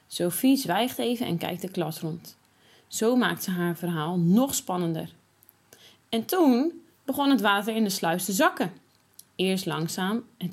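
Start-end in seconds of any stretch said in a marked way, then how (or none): none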